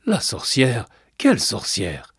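taken-aback double question; puzzled. A narrator's reading. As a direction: query it as a baffled grandpa. Grandfather puzzled by the boy.

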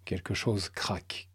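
ordinary delivery

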